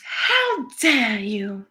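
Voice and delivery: put-on very slow, drawn-out Greta Thunberg accent; deliberate mock scolding, Greta Thunberg accent. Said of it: "Did I get the accent right".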